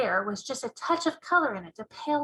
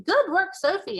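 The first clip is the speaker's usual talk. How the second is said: lightly voiced teacher, high and pleased; approving teacher voice; 8 semitones higher, 5 dB louder than usual. Teacher praising Sophie's sums, a hint of high delight.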